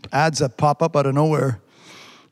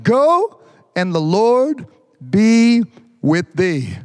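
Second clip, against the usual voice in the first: slow, drawn-out solemn blessing; emphatic scripture reading; 6 semitones higher, 4 dB louder, much slower than usual. Acted. Pastor rereading the verse, slow and solemn. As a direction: lean hard on the Lord.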